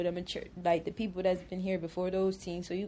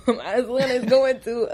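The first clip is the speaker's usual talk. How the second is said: laughing; 5 semitones higher, 12 dB louder than usual. Real, not acted. Laughing while explaining her son's school.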